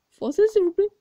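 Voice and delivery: high, loud mock plea. Student mocks the teacher's English with a high plea. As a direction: squeak it out in mockery.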